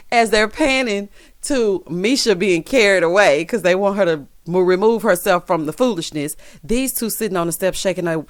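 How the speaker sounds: ordinary talk, nothing marked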